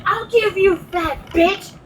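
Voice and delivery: high-pitched indignant comeback; retold angry threat. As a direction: fire the insult back as a threat. Retelling her angry comeback to insult.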